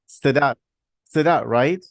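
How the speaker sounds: Sedat said carefully, testing the name; trying out a name. Real, not acted. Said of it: "Did I say it right?".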